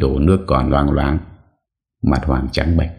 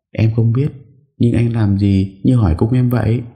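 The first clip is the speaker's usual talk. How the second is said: put-on higher-pitched young woman, puzzled and a bit defensive; higher young woman's voice, wary; young wife's voice; 9 semitones higher than usual. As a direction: voice the wife, higher, pushing back.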